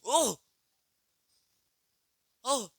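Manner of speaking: high loud excited oh. Real, not acted. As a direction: burst out in delight. Excited exclamation in game.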